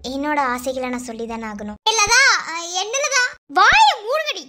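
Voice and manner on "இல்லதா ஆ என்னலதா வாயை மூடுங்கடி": very high, loud yell; angry shout. Acted. Elder yelling at the family to be silent, angry shout. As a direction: roar at them to shut up.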